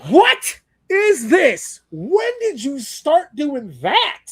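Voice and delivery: confused at the cringe line; mock bafflement. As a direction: stretch it out in baffled disbelief. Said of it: "And I went".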